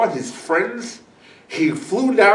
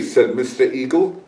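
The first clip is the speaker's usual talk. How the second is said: ordinary delivery, nothing marked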